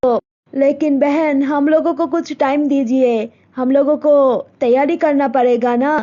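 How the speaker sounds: mother telling daughter about a proposal; Malti's mother. Acted. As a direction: speak as a set-in-her-ways village mother. Mother asks for time.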